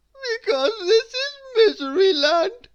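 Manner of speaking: high, loud weeping voice. A narrator's reading. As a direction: weep it like a miserable king. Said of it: "wept the king".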